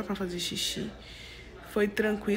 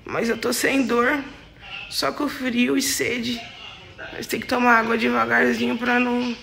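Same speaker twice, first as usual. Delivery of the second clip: drowsy, heavy post-op voice; strange groggy voice; 6 dB louder than usual. Real, not acted. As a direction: talk like you just woke from surgery. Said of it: "A minha voz tá meio estranha". (voz estranha pós-anestesia)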